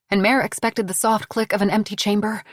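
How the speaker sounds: ordinary delivery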